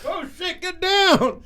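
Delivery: high-pitched cry to take cover; mock alarmed shout. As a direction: yell a warning as if under fire. Acts out reacting to gunfire.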